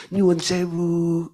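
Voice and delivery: slow, sweet, deferential apology; a gently sugared excuse-me; polite Javanese. Retold or imitated. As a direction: say it with exaggerated Javanese courtesy. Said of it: "Tapi kalau orang Solo".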